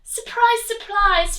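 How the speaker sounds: high, loud mock surprise; teasing storybook surprise. A narrator's reading. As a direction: announce it with playful amazement. Narrator exclaiming.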